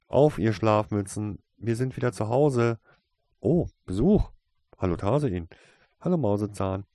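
loud, bright arrival greeting; cheery calling out. A narrator's reading. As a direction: call out merrily to the whole house.

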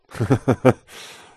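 loud, hearty acted laugh; big booming burst of laughter. A narrator's reading. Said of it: "lachte Mister Darkcloud".

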